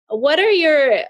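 ordinary talk

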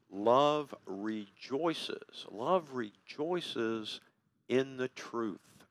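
drawn-out emphasis. Real, not acted. Preacher drawing out key phrase.

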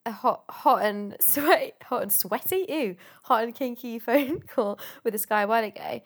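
usual speaking voice

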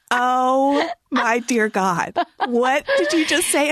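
slow, drawn-out astonishment, acted out; mock shock. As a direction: imitate an appalled friend, half laughing. Retold shocked friend reacting to accent.

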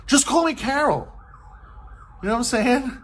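mock-exasperated just call me Carol. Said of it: "and it's like".

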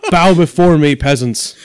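hammed-up tyrant king; megalomaniac rant. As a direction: lord it over the peasants. Mock king of Origins.

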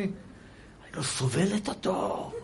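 soft, drawn-out acted disgust; hammed-up quiet aversion; mock loathing wife. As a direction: play a wife who can't bear him, slowly. Acted wife loathing her husband.

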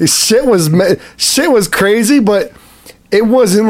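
ordinary talk, nothing marked

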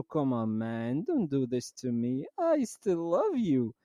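very slow, pleading English; pleading with laptop cable. Retold or imitated. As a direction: beg the cable not to leave. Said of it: "أقول له".

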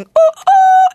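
loud, high-pitched mock "ooh"; mimicked heckler noise. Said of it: "someone kept going".

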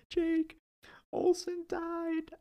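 high, slow, wailing imitation of a crying girl; hammed-up sobbing phone call, stretched thin and high; mock crying cousin. Retelling his cousin's call after the mouse died, mock weepy wail.

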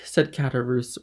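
ordinary delivery

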